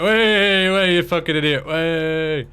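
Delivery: high, loud, stretched calls; jeering high holler; drawn-out yell. Real, not acted. Yelling in game.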